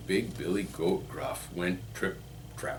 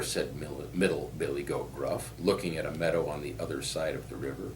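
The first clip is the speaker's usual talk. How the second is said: usual speaking voice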